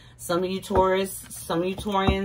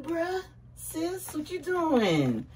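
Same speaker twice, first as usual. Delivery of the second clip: teasing sing-song; 11 semitones higher, 3 dB quieter, much slower than usual. Real, not acted. Teasing high greeting.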